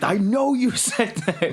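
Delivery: knowing, disbelieving dismissal; gaslighting customer. Retold or imitated. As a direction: brush off what the server says. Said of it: "server being gaslit thing".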